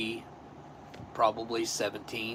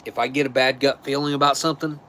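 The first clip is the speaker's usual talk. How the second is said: ordinary talk, nothing marked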